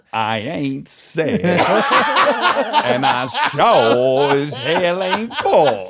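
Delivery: extremely slow, dragged-out vowels; hammed-up slow Southern drawl; imitating the drawling uncle. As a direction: rise high and stretch each word for effect. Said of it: "she immediately goes".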